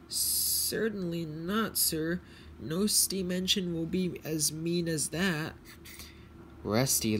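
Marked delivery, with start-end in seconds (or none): 0.1-5.6 earnest engine. Acted. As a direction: deny it earnestly in a raised voice. Luke answers Sir Topham Hatt.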